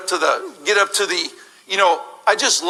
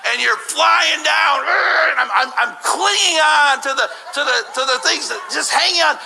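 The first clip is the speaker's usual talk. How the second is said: panicked reliving; 8 semitones higher than usual. Acted. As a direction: relive the fall clinging on in panic. Reliving the drop.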